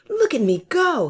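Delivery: high, loud boasting hare; bragging. Acted. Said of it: "he bragged".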